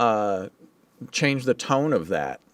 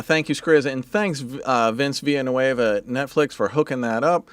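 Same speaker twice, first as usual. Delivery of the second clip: ordinary talk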